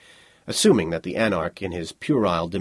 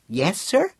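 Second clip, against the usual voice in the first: put-on high-pitched, mildly sullen child; sullen boy; 9 semitones higher than usual. A narrator's reading. Boy reluctantly looks up at his petitioner, sullen and high. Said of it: "he said in a sullen, muted voice".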